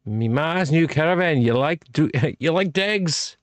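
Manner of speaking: put-on high, thick Irish traveller accent, mumbled; mock Irish traveller accent. Quoting Snatch to a drinking buddy in a mock Irish traveller accent. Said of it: "go into that language style".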